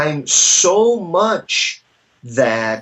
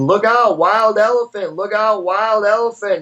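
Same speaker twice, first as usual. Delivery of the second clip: raised, higher warning call; playful mock shout of look out; mock yell; 6 semitones higher than usual. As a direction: call out the warning to clear the street.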